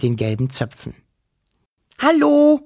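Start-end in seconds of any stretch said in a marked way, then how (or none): 2.0-2.6 loud, high-pitched holler; high call. A narrator's reading. Wischel calling to the girl from the bushes, high call. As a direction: call out high, holding the last vowel. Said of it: "rief Wischel".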